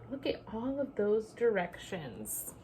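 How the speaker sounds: ordinary delivery, nothing marked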